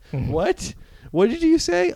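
squeaking high in disbelief; a mock double take; hammed-up shock at the news. Hero shocked about his daughter.

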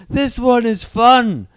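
loud, high, drawn-out delighted warrior; Drax, gleeful brawler. Acted. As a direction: slowly boom it out, relishing the fight. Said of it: "Drax just laughing the whole time, being like".

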